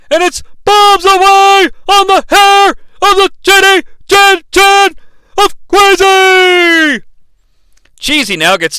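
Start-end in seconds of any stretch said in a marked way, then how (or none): 0.1-7.0 high, loud announcer yell, stretched at the end; excited shouting. Acted. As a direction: belt it out like a hyped commentator. Calling a top-rope dive.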